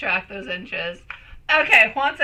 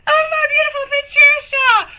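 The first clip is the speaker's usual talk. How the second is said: tearful laughing cry out; 12 semitones higher, 6 dB louder than usual. Real, not acted. Laughing tearfully at her friend.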